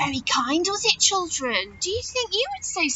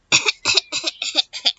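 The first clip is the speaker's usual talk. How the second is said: little run of staccato coughs; acted throat-clearing cough in short bursts; cricket's cough. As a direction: cough politely for attention, as the cricket. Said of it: "coughed a cricket".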